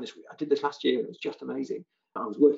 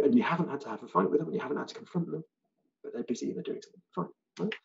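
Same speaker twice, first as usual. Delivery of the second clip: ordinary talk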